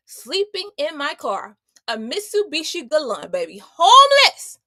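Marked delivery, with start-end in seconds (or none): 3.6-4.6 Homeless. belted out high and loud; loud, stunned emphasis on her own past; emphatic exclamation. Real, not acted. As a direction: throw the word out with full force.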